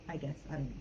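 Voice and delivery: usual speaking voice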